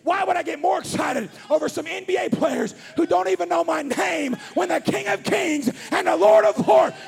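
loud, rising sermon climax; full-force preaching. Acted. Preacher to congregation, shouting salvation at full force. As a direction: preach it to the rafters, building.

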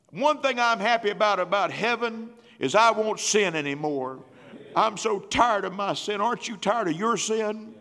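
high, loud exhortation; fervent preaching. Real, not acted. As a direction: preach it with fire.

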